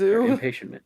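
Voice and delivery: jumps high on "Very impatient man"; sudden high correction. Acted. The host catching his own words, voice leaping up. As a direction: snap the pitch up as he corrects himself.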